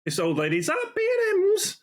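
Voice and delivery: high, thin old lady impression. Mimicking an old lady complaining at the till in a high old lady voice. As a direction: squeak it out like an elderly shopper.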